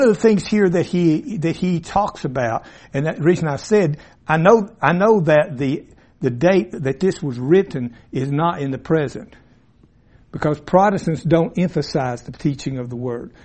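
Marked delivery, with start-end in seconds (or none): none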